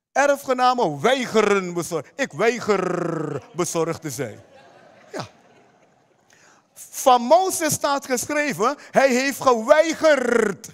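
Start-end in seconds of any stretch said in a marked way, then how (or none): 2.2-4.4 "weiger" drawn out with a long rolled r; slow, low, defiant stress on "weiger"; emphatic refusal. Real, not acted. Preacher drives home refusal. 8.9-10.7 "geweigerd" loud, with a long rolled r; slow down and punch "geweigerd"; emphatic refusal. Real, not acted. Preacher drives home refusal.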